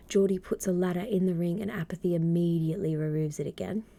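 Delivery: ordinary talk, nothing marked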